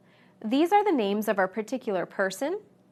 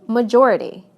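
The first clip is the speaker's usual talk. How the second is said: ma-JOR-ity, loud JOR; majority with the weight on JOR; stress before the suffix; 7 dB louder than usual. Shown as a demonstration. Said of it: "stress moves right to the syllable".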